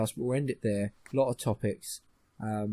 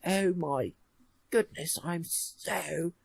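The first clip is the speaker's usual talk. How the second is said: higher, slow, prissy put-on posh voice; posh mock outrage; 4 semitones higher, much slower than usual. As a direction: ham up a fussy aristocrat. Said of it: "Oh, bother".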